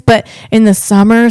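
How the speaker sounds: ordinary talk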